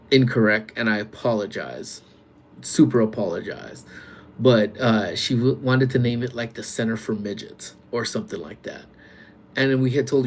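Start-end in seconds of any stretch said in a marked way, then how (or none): none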